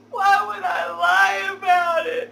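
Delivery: high, loud, hammed-up sobbing cry; fake sobbing shout. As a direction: wail it like fake tears are pouring. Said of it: "fake tears that I can create".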